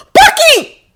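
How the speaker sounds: very high, loud frantic call; tearful panicked shout; desperate, watery cry. A narrator's reading. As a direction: call out close to tears. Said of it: "watery voice".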